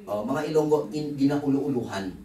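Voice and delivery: ordinary talk, nothing marked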